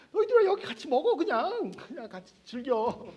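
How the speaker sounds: high, loud welcoming call that slows at the end. Acted. Jesus calling the Pharisees to eat, loud and high. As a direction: wave them in to the feast.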